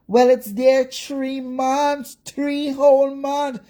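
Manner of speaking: slow, high, mocking imitation of his excuse; drawn-out sneering mimicry; mocking Chris. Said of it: "coming on a live today to further".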